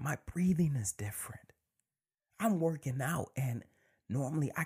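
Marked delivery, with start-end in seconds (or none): 0.0-1.5 breathy whisper settling down; whispered, falling. Real, not acted. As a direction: whisper it, landing firm. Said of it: "When I tell you".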